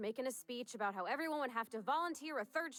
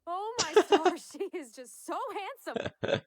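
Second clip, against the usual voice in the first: gushing excitement; 8 semitones higher, 11 dB louder, slower than usual. Acted. Gushing over the ship.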